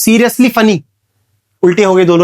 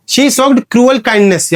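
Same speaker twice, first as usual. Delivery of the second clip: very slow, a bit higher, each word spaced; slow example read; 4 semitones higher, much slower than usual. Shown as a demonstration. Teacher reads the oxymoron example slowly to students.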